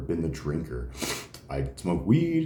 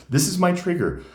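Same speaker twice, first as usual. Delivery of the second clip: retold exasperated outburst; 9 semitones higher, 4 dB louder than usual. Telling brother his trigger.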